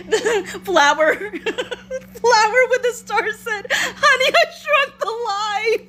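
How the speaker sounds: high, helpless laughter through the words; slow, squeaky struggle through giggles; laughing while reading. Real, not acted. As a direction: read it out while cracking up. Live stream comments crack her up.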